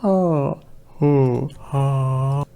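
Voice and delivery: tight, squeezed throat sound; pinched voice. Shown as a demonstration. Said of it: "tightening my throat".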